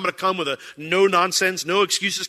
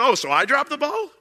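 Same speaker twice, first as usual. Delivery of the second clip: mock indignant Lord; 10 semitones higher, 4 dB louder than usual. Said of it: "it's almost like the Lord's going".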